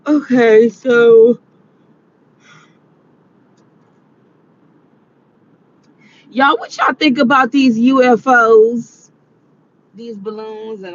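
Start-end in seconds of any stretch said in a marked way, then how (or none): 0.1-9.1 loud energetic new topic; louder. Real, not acted. Loud restart, topic change.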